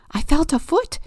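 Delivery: high loud thrilled report; excited. Acted. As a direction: burst out with the news. Excited discovery.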